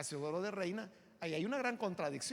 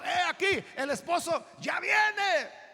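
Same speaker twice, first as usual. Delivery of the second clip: high, loud proclamation; heralding shout; 8 semitones higher, 7 dB louder, slower than usual. Acted. Voice at midnight announcing the bridegroom, loud drawn-out shout. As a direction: cry it out, stretching YA VIENE.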